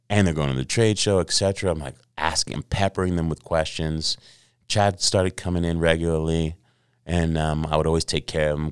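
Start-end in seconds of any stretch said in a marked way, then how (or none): none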